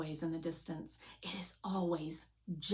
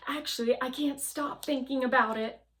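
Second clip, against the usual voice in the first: anxious rushing; 5 semitones higher, 6 dB louder, faster than usual. Acted. Fly anxious, rushing words.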